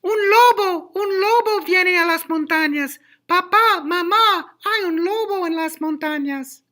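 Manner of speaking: put-on very high, loud boy's cry; boy shouting. A narrator's reading. Shepherd boy crying wolf to his parents, high loud shout. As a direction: yell out in a high, piercing boy voice. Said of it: "Adalberto grita".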